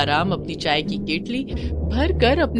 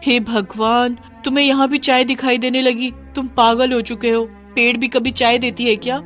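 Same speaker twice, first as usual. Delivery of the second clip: raised-pitch scolding disbelief; exasperated wife; 4 semitones higher, 4 dB louder than usual. Acted. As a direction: scold him as if he's lost his mind. Wife scolds seemingly crazy husband. (झुंझलाकर डाँटती पत्नी)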